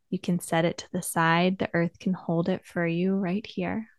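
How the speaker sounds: soft, unhurried meditation voice with long pauses; guided meditation. Acted. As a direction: gently guide listeners inward, leaving space between phrases.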